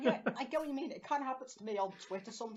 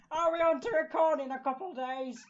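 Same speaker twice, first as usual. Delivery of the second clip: lightly voiced text from a friend, a bit higher; mock friend voice; 6 semitones higher, 5 dB louder than usual. Reading a friend's text mid-cry, in a lightly put-on voice. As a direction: read his text in a slightly put-on voice. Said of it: "how you represent my voice".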